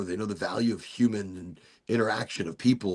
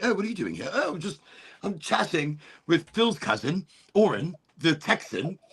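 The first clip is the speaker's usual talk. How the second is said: prim British friend; 7 semitones higher than usual. Retold or imitated. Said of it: "they're like".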